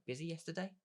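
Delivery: "Busy yesterday?" lifted high into a questioning rise on "yesterday"; rising echo. Shown as a demonstration. Coach demoing a short mirror of a friend's words, rising question. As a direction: toss the two words back as a bright question. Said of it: "words don't sound right".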